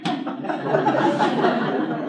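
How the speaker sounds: airless giggle after the joke; breathless giggle. Real, not acted. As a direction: let out a breathy laugh. Laughing at own example.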